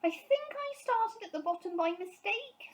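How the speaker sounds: high small bear, glum; sad admission. A narrator's reading. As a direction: confess it sheepishly. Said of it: "said Paddington sadly".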